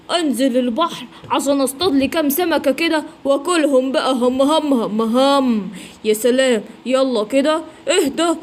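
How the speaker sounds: usual speaking voice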